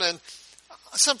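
normal talking voice

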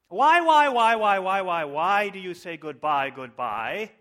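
very loud, high wail of whys, falling away; mock-anguished outburst. Preacher hamming up Beatles lyrics for congregation, mock-anguished shout. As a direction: erupt in overblown despair, then come down. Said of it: "I hope you're as moved by these".

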